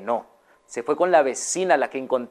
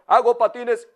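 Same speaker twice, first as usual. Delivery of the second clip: lightly voiced peddler repeating his wares; a hint of a street hawker; vendor's call; 6 semitones higher than usual. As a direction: call out wares again, unhearing. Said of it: "Y el señor".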